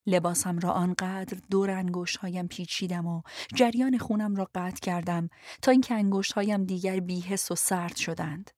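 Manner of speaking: ordinary delivery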